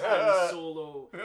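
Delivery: loud, slow Scottish threat; growing mock-Scottish bellow, drawn out; exaggerated Scottish accent. Co-host mimics Scottish guy threatening Han Solo, thick Scottish accent. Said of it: "so Scottish".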